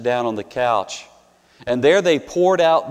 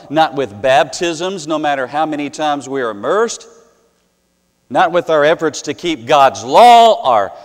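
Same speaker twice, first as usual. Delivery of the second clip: preaching; 2 semitones higher, 6 dB louder than usual. Acted. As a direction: hammer each line home from the pulpit. Preaching at full force.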